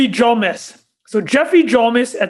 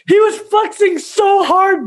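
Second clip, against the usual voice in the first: laughing shout; 8 semitones higher, slower than usual. Real, not acted. Repeated louder laughing.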